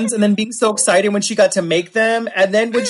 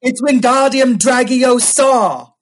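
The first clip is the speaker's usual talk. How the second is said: loud, drawn-out spell-casting; high, slow, show-off incantation; Hermione Granger; 5 semitones higher, 5 dB louder, much slower than usual. Acted. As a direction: imitate an overeager swot casting a spell. Mimics Hermione Granger of drag.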